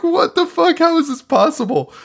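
high-pitched incredulous outcry; mock disbelief. Viewer watching the doctor stop the car, incredulous. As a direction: squeal it like a baffled viewer.

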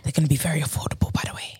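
quick, soft whisper trying to sound sexy. Acted. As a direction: whisper fast and close, teasing. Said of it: "Oh, with the whisper?".

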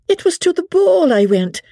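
very high, protesting outburst; crying out. A narrator's reading. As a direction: cry it out in high-pitched protest. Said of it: "cried out Becky".

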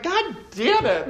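high, slow, hammed-up groan of frustration; mock exasperation. Catching herself using a ladder metaphor, hammed-up despair. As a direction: act fed up with a wink. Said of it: "I'm like".

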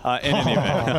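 can barely get the words out for laughing; laughing through words. Real, not acted. Host cracks up at his own name pun, laughing.

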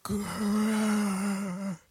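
snarling zombie growl. Retold or imitated. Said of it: "[name]'s actually really good impression".

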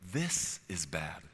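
slow, measured voice of conviction. Acted. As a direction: point it out slowly, without attacking. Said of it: "The voice of conviction says this".

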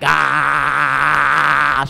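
long loud playful "aah"; sustained holler imitating a kid jumping; bouncing kid yell.

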